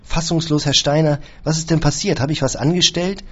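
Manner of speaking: pleading in panic, voice up high; utterly distraught. A narrator's reading. Caretaker panicking at his employer's accusations, high and distraught. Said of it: "Franz war jetzt völlig verstört".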